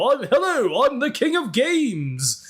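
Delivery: grandiose parody duelist, high swooping pitch; Yami Yugi. Shown as a demonstration. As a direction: announce yourself like a pompous anime champion. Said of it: "I can do Yama Yugi".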